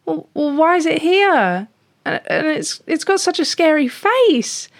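high-pitched, worried little cat; fretful cat. Acted. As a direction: stammer and protest in a high kitty voice. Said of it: "And Speckle goes".

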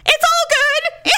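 high, strained fake-okay voice; trying not to cry. Woman reassures husband after falling, holding back tears. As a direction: squeak out reassurance, drawn out and tearful. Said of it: "I'm trying not to cry".

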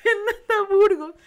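high, slow, embarrassed laughing repeat of the name; laughing at herself. Retold or imitated. The host repeats her childhood phone greeting, laughing with embarrassment. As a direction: laugh through your own name, cringing. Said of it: "Hasta vergüenza ahorita".

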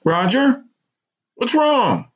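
high, anxious mother's voice; alarmed mother asking what happened; worried mother. A narrator's reading. Mother hears son's broken voice.

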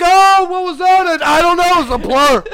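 flustered. Retold or imitated. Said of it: "I'm just like".

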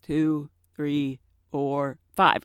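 slow, quiet counting to five; slow careful count. Shown as a demonstration. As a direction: count it out deliberately. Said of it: "I stop and I count to five".

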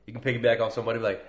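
ordinary delivery

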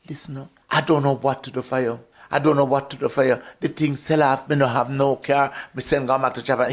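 lightly voiced Jamaican patois client, quick. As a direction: retell the client's news in patois, fast. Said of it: "He says".

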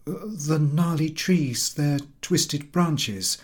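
slow, sing-song recitation; reciting poem. Acted. Schoolboy reciting the poem to the professor, slow rote.

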